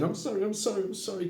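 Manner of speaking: high, frightened string of apologies; Grinwit, still shaking. Acted. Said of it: "still shaking, still saying".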